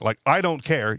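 ordinary talk

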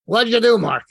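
loud, high Brooklyn grumble; impatient Brooklyn old-timer, accent. Retold or imitated. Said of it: "thick Brooklyn accent".

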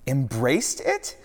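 high, loud, slow incredulous echo; crying out in disbelief. Acted. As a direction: throw the words back at him. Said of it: "Peter cried".

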